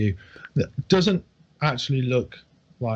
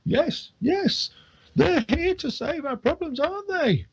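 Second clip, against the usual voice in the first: put-on much higher, wheedling old drunk; drunk old man. Old drunk asks tavern folk to agree, high wheedling. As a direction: coax the others into agreeing.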